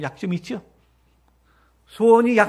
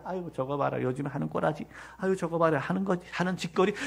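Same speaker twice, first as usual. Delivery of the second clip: scornful mutter; 6 semitones lower, 12 dB quieter than usual. Retold or imitated. Judging others, muttered scorn.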